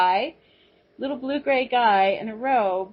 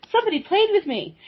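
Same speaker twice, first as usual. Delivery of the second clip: much higher, fast, delighted cat; happy cat voice; 11 semitones higher, faster than usual. Retold or imitated. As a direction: squeak it out like a pleased kitty. Voicing the happy purring cat.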